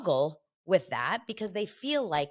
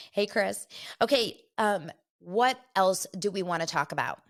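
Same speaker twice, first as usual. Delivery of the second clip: ordinary talk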